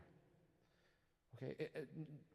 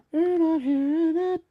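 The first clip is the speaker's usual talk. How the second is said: much higher, loud mock squeal; silly high voice; 13 semitones higher, 14 dB louder than usual. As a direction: repeat it in a goofy high-pitched voice.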